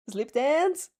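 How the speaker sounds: ordinary delivery, nothing marked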